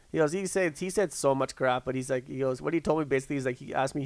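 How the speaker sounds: ordinary talk, nothing marked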